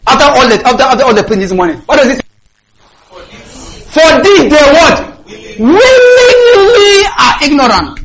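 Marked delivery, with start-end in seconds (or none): 3.9-8.0 high, slow, near-crying cry; impassioned preaching. Real, not acted. Preacher crying the verse out to the church, high and slow. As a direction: wail the words out.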